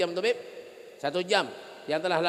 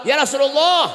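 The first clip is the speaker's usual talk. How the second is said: calling out; 5 semitones higher, 9 dB louder than usual. Acted. Fatimah voiced calling the Prophet.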